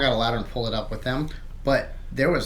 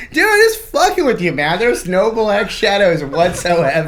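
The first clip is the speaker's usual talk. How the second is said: high, loud insistence with a laugh; raised voice, half-laughing reassurance; exasperated confession; 7 semitones higher, 6 dB louder than usual. Retold or imitated. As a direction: insist it was all a prank, laughing. Owning up while friend panics.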